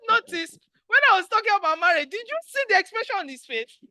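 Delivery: giddy, laughing announcement; excited laughing. Real, not acted. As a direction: gush about his reaction, laughing. Excited teasing host.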